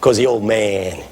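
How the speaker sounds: put-on low, slow, unhurried old preacher; quietly confident elder putting the youngster in place; imitated old pastor. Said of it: "He said".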